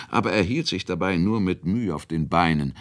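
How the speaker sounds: ordinary delivery, nothing marked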